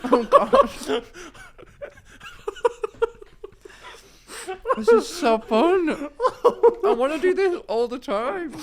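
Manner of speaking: high, giggling through a full mouth; laughing helplessly. Real, not acted.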